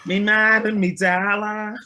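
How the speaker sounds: "Me mad and me dollar" in a thick Liverpool accent; Liverpudlian accent. Shown as a demonstration. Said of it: "That was a good one".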